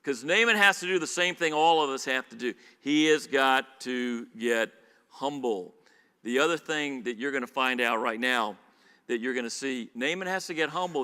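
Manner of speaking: ordinary talk, nothing marked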